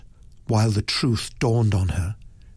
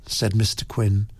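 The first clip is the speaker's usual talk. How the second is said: ordinary delivery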